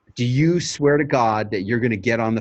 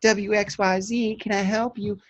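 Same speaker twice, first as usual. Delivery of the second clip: receptionist answering; 10 semitones higher than usual. Shown as a demonstration. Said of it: "Somebody's gonna say".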